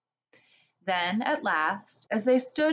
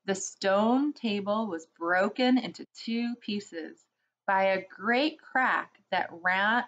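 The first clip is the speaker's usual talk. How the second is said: ordinary delivery, nothing marked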